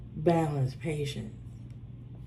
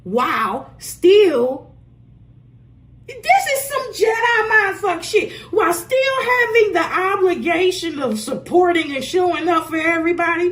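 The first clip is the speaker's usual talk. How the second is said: loud exasperated outburst, higher and louder; frustrated shout; 8 semitones higher, 7 dB louder than usual. Real, not acted. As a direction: yell it out in disbelief at the unfairness. Exasperated rant about giving support.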